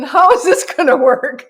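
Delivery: amused mock-baffled outburst; exclaimed bafflement. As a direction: blurt it out as a comic quandary. Voices rescuers' bafflement, amused.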